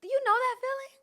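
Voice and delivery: high, squeezed voice acting choked-up; tight throat. Shown as a demonstration. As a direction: push it through a throat holding back tears. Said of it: "your throat gets tight".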